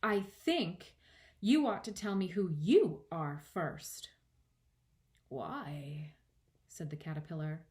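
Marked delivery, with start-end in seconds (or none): 0.0-4.1 firm, measured, a little louder; understated grave girl character. A narrator's reading. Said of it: "said very gravely". 5.3-6.1 soft, drawn-out question; sleepy, unimpressed caterpillar; languid caterpillar. A narrator's reading.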